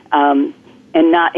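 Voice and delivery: ordinary talk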